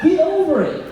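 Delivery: high, loud get over it, punched on get; exasperated shout. Acted. Coach tells his audience to get over it, exasperated shout. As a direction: snap it at grown-ups acting like kids.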